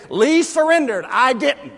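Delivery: loud, high mock rebel defiance; defiant slogan. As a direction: proclaim it like a proud Southern rebel. Defiant Confederate poster slogan.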